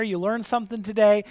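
ordinary talk, nothing marked

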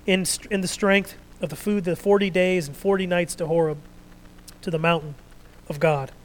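loud, declaiming Bible reading; preacher reading the Elijah story aloud, loud and measured; scripture reading. Acted. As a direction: proclaim the passage to the congregation.

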